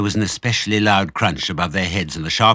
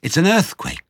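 alarmed cry; 10 semitones higher, 4 dB louder than usual. A narrator's reading. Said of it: "cried Missus Fox".

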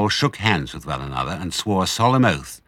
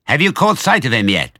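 raised, loud question; calling back; 5 semitones higher, 6 dB louder than usual. A narrator's reading. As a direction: call across to the other tractor. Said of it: "Bean called back".

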